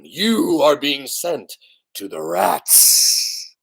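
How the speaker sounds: plain reading voice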